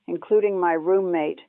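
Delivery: ordinary delivery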